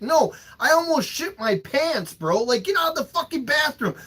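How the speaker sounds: high, hammed-up desperate protest; exasperated plea. Acting exasperated plea to daughter.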